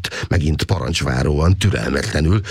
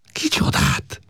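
high surprised question; wondering; 13 semitones higher, slower than usual. A narrator's reading. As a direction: ask in astonishment. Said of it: "nézett rá csodálkozón".

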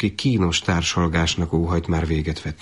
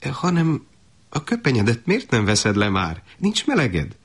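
higher-pitched, lilting motherly urging; mother fussing over her son; eager coaxing; 6 semitones higher than usual. Acted. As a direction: coax him along with rising eagerness. Mother coaxing son to see room.

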